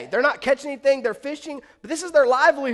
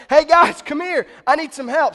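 loud, higher-pitched call for help; yelling to buddies; 5 semitones higher, 8 dB louder than usual. Acted. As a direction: call out urgently to friends on shore. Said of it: "he's yelling to his buddies on the shore".

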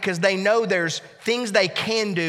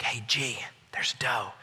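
whisper; 7 semitones lower, 10 dB quieter than usual. Retold or imitated. Whispering to son in blind.

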